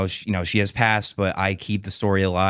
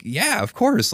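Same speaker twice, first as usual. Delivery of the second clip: much higher, starstruck agreement; eager yes; 12 semitones higher, slower than usual. Retold or imitated. Dad answering Gordie Howe, high eager. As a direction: gush a quick yes.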